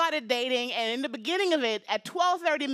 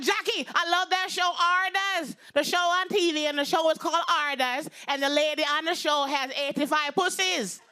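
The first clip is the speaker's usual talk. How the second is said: put-on heavy Jamaican accent; 3 semitones higher than usual. Mom raving about a TV show, heavy Jamaican accent. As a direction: imitate mom's thick Jamaican accent, pitched up. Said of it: "her very heavy Jamaican accent".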